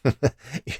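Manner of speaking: short amused laugh. A narrator's reading. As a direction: chuckle in relief. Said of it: "began to laugh".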